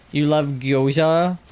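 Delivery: "gyoza" said slowly as kyoh-zha, unsure; "gyoza" tried out slowly, hesitantly; unsure pronunciation. Real, not acted. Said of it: "I don't actually know how to pronounce that".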